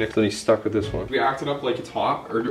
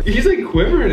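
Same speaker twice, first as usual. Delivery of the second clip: high, loud amazed exclaim; gleeful disbelief; excited; 13 semitones higher, 9 dB louder than usual. Real, not acted. Laughing at friend's reaction.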